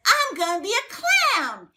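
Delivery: put-on a child announcing he'll be a clown; little boy voice. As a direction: play the small boy, eager and proud. Little boy answers her question.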